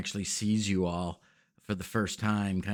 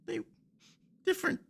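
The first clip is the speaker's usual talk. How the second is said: put-on much higher child voice, halting and stricken; a little boy telling how his family was killed; high, broken child voice recounting the raid; grieving young boy.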